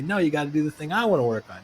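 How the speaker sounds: lightly acted overruling; mock insistence. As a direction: push back like a stubborn bandleader. Acted back-and-forth quarrel between bandmates.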